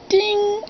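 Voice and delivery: high, ringing "ding" held on one pitch; imitated piano note. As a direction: mimic a struck piano key being tuned.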